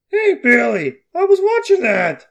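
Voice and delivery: high, loud cartoonish uncle; goofy uncle character. Voices Uncle Brewston in script.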